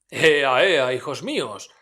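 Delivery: más fuerte, animoso; grito jovial. A narrator's reading. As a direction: anima a los muchachos a voz en grito. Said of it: "gritó Fezziwig". (Fezziwig grita a sus aprendices, jovial)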